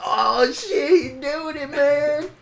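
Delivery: high, strained imitation of a stunned bystander; amazed onlooker. Friend taking pictures of the dare, high amazed squeal.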